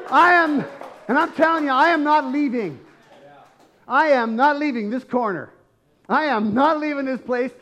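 loud, high declaration of refusal; defiant shout. Acted. Believer refusing to leave without Jesus, defiant shout. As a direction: stand your ground at full voice. Acting a believer who won't be silenced.

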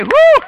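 loud high whoop of praise; joyful whoop. Real, not acted. Preacher whoops with joy as church claps, loud and high. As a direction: let out a big celebratory whoop.

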